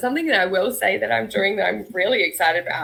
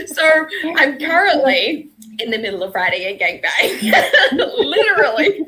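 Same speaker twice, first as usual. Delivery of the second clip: laughing; 6 semitones higher, 3 dB louder than usual. Real, not acted. Laughing about writing gangbang.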